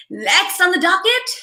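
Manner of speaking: high announcer-style catchphrase, rising at the end. Acted. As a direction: mimic a host calling up the next item. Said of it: "no, I'm not [name]".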